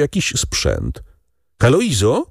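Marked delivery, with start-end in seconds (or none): 1.6-2.3 calling her name, raised and questioning; higher-pitched questioning call; repeated call. A narrator's reading. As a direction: call again through the door. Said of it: "powtórzył".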